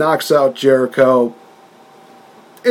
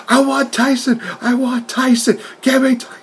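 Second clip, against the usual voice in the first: much higher, shouted demand; hammed-up frantic yelling, pitched way up; mock yell; 12 semitones higher than usual. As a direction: yell for Tyson like a man possessed. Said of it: "is just yelling".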